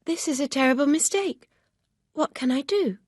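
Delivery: a hint of a firm young lady; Emma, lightly voiced, reproachful. A narrator's reading. Emma dismayed.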